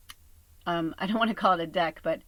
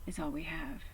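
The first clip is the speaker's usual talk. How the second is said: soft hushed whisper; 6 dB quieter than usual. Real, not acted. Telling viewers that right now is all they have, whispered. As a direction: whisper it as a quiet truth.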